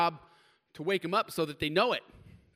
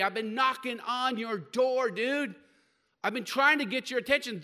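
raised, louder voice of God pleading with Saul; 5 semitones higher, 5 dB louder than usual. Acted. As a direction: call out like God pressing a stubborn man. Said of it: "he heard the voice of God and said".

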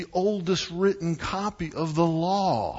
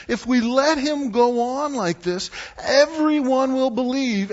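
high and loud, a frantic voice; the worried leaders rushing their fears out; panicked council; 10 semitones higher, 5 dB louder than usual. Acted. Council members fretting to each other, high and alarmed.

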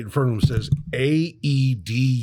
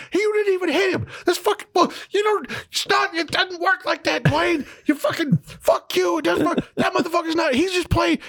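imitating screaming. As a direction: screech like a furious Kevin Hart. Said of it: "Kevin Hart is screaming at him".

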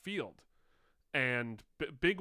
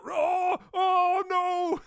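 very high, loud panicked shriek; mock rabbit scream; 10 dB louder, much slower than usual. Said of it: "this rabbit was screaming".

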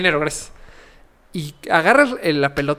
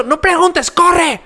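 panicked shout; 11 semitones higher, much slower than usual. Retold or imitated. Warning his friend Güero at the club, shouting in panic. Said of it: "y cuando grito".

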